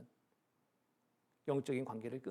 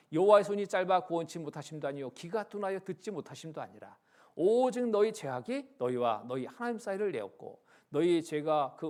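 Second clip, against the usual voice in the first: louder, solemn formal reading of scripture; 8 dB louder than usual. Real, not acted. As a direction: proclaim the verses to the congregation. Reads scripture aloud.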